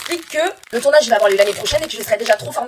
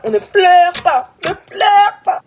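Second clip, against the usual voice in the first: high, slow, louder mocking voice; shrill-free high mock nag; mock scolding doctor; 8 semitones higher, 4 dB louder, much slower than usual. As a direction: parody the doctor, much higher.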